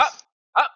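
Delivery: curt, repeated "ah"; short "ah" noises. Shown as a demonstration. Interrupting a scam recording, short "ah" noises. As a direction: cut in with little blips of "ah". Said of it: "if you just go".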